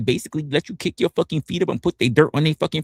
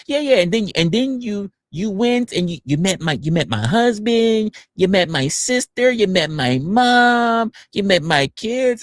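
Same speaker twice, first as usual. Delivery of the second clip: high-pitched imitation of a reminiscing caller; mock eager accuser; 7 semitones higher than usual. Accuser reminding streamer of San Diego, high mock voice.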